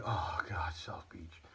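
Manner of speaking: low, hushed groan of dismay; groaned under the breath, hammed-up dread; mock disappointment. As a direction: feign a let-down, sinking into a whisper. Mocks a let-down reaction to flavor.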